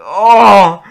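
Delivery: high, very loud drawn-out "oh"; loud groan of dread. Retold or imitated. Student called out by the teacher, loud wail of dread. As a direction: wail an exaggerated "oh" in alarm.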